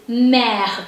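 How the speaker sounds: mère as one syllable, "mehr"; mère with breathy French r, silent e; French pronunciation. Shown as a demonstration. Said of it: "E is a silent letter".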